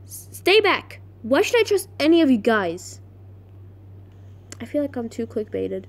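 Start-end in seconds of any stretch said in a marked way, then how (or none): 0.0-3.0 acted defensive warning; wary, forceful challenge; Riley. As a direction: start high on a sharp warning, then demand an answer. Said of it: "Riley was about to go".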